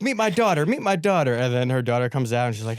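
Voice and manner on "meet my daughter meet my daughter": high-pitched mock enthusiasm; eager, imitated. Woman introducing her daughter to new friend, mock excited.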